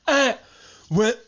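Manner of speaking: hammed-up deaf-speech impression; mock deaf speaker. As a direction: ask it in a muffled deaf caricature. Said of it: "I do a very good deaf impression".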